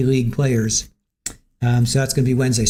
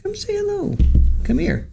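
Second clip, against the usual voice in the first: pet talk. Real, not acted. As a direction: coo at the cat to come over. Coaxing the cat.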